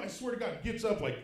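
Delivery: ordinary talk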